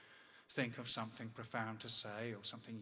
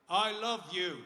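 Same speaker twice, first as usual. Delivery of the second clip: louder, higher, for a hard-of-hearing dad; raised voice; 7 semitones higher, 10 dB louder, slower than usual. Retold or imitated. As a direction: project it to someone hard of hearing.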